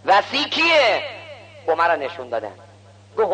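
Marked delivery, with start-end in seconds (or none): 0.1-1.0 high, ringing challenge; demanding call. Acted. Visiting scholar demanding to know the successor, demanding call. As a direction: call the question out with force.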